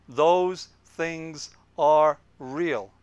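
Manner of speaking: very slow, each word drawn out; drawn-out, deliberate four words; slow motion. Shown as a demonstration. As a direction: let each word land slowly. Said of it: "I'll do it in slow motion".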